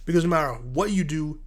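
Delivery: ordinary talk, nothing marked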